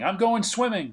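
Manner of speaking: high-pitched, drawn-out imitation holler; mock yell. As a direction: imitate a drunken man yelling his plans. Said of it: "Dutch is at some point yelling".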